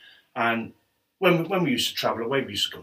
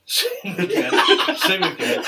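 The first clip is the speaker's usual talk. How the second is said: laughing it out, a notch higher; 8 semitones higher, 5 dB louder, slower than usual. Real, not acted. Telling mates about his pay, laughing. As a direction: repeat it through laughter. Laughing over the wage offer.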